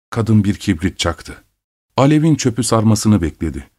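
ordinary delivery, nothing marked

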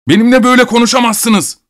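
high, indignant yell; outraged, full-voiced protest; angry shout. A narrator's reading. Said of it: "diye bağırdı".

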